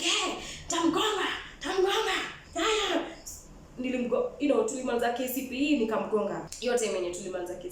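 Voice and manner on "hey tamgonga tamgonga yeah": high, playful egging herself on; high mock hype. Girl hyping herself up to hit someone, high and playful. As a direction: chant it up high like a dare. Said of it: "I was like".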